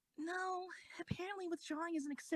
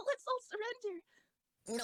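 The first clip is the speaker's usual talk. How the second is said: nervous laugh; 7 semitones higher, slower than usual. Acted. Nervous laugh proposing surrender.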